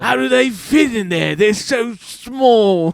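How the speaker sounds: mock British. Marvelling at tiny knickers, in a mock British voice. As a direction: play a fussy colonial gent.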